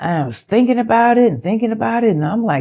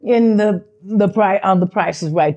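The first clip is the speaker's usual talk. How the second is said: normal talking voice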